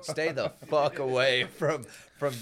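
slow, loud, punched demand; angry slow yell. Real, not acted. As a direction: drag it out and punch fuck. Yelling at the drink maker.

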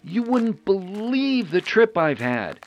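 relieved greeting. Acted. Greeting friends after ordeal.

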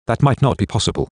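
ordinary delivery, nothing marked

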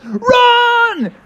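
panicked shout. Acted. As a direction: yell it while fleeing for your life. Fleeing the gator.

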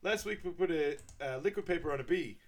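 put-on presentation voice; imitated presenter. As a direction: proudly report it to a room, in imitation. Acting out a business presentation.

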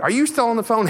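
mom yelling upstairs. Retold or imitated. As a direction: call up the stairs like mom. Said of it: "mom yells upstairs".

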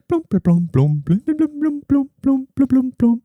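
high-pitched mimicked metal drum plinks; high "blum" notes bouncing like a tapped drum; imitating instrument. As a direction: vocalise light, high chimey tapped notes. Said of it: "if you tap on different things, like".

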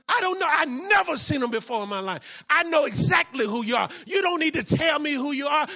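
a furious relative shouting the pastor down; angry shout. Retold or imitated. Angry brother-in-law confronts pastor.

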